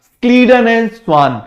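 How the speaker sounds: slow, deliberate names to repeat; teacher leading a slow choral repeat; repeat after me. Shown as a demonstration. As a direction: say Scleden and swan slowly so students echo it. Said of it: "मेरे साथ बोलो जरा".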